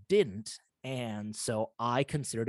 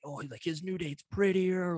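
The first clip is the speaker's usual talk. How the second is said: higher, put-on catty voice, mocking; snide mocking quote; 6 semitones higher than usual. Followers taunting the old date, in a snide high mock voice. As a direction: sneer it like a mean online comment. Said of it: "mean comments".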